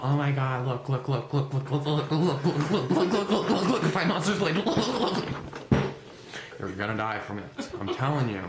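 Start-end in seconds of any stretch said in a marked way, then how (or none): 0.0-5.3 put-on excited kid. Imitating kids at the mall, building to a giggly squeal. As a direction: ham up a giggly kid tugging at a parent. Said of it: "little kids go around the mall".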